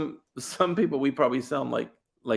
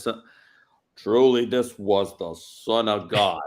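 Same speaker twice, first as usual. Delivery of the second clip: slow, low cowboy drawl; ponderous western-star delivery, every word stretched; John Wayne; 4 semitones lower, slower than usual. Acted. Centurion looking up at the cross, slow drawl. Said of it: "John Wayne looks up at the cross and says".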